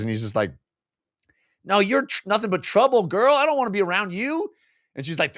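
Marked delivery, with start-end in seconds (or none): 1.6-4.5 high-pitched hammed-up refusal; mock indignant rejection. Retelling him rejecting the girl, mock indignant. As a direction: push her away in a raised comic voice.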